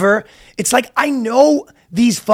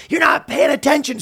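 retold yelling; 6 semitones higher than usual. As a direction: imitate a nagging tantrum lightly. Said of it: "She's yelling and screaming".

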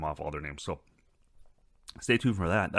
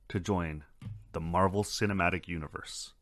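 ordinary talk, nothing marked

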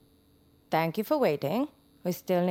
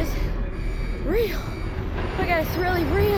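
terrified disbelief; 10 semitones higher, 5 dB louder, much slower than usual. Acted. Realizing the monster is real.

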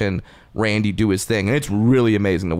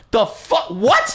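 way higher, cracking "what?"; stunned disbelief; 13 semitones higher, much slower than usual. Retold or imitated. Judge reacting to the puppet reveal, shocked and high. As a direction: squeak out total confusion. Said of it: "Pete Holmes was just like".

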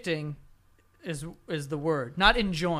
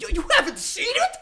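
high, loud, incredulous gushing; mock excitement; 14 semitones higher, 9 dB louder than usual. Friend shocked you haven't seen it, exaggerated excited yelp. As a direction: imitate an overexcited friend, voice shooting way up. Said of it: "they just get so excited".

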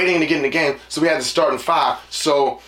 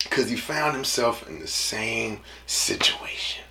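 slow, hushed whisper; 6 semitones lower, 6 dB quieter, slower than usual. Acted. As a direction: let the punchline slip out quietly. Punchline delivered low.

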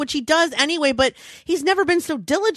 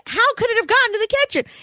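high, rapid exasperated question; exasperated disbelief; 9 semitones higher, faster than usual. Retold or imitated. Wife to husband searching for the pacifier, exasperated. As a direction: fire back incredulously, pitched way up.

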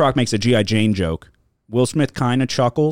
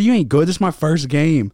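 high, loud heated taunt; mock trash talk; 8 semitones higher, 5 dB louder than usual. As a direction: taunt like an irked opponent, voice pitched up. Retelling heated trash talk mid-match.

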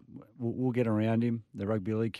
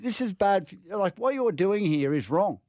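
retold scolding; 9 semitones higher, 4 dB louder than usual. Retold telling off the manager.

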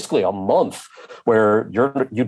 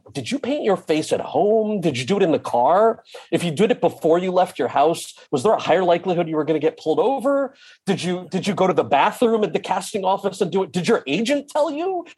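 incredulous questions climbing higher and higher; fascinated questioning; 8 semitones higher than usual. Retold or imitated. Actor quizzing brownface performers about their makeup, fascinated. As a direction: fire off baffled, high-pitched questions.

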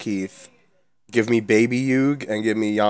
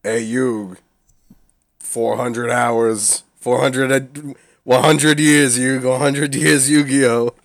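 Yu-Gi-Oh Abridged impression; 3 semitones higher, 4 dB louder, slower than usual. Imitating Yu-Gi-Oh Abridged character.

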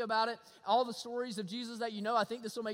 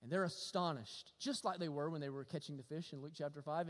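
usual speaking voice

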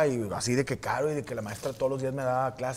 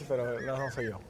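ordinary delivery

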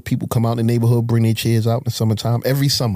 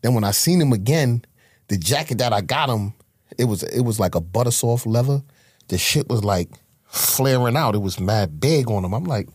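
usual speaking voice

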